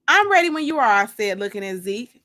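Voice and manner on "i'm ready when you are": higher, louder eager line. A narrator's reading. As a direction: sound eager to get going. Eager to leave with date.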